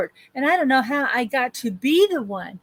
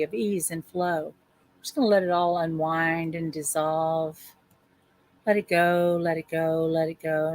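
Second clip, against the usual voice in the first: guided meditation; 5 semitones lower than usual. Acted. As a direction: ease them into release.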